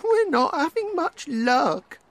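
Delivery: very high duck voice; squeaky high little duck, sad. A narrator's reading. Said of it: "said the sad little duck".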